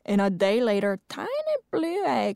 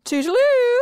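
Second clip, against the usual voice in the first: high chirpy farewell; sing-song goodbye; 6 dB louder than usual. Acted.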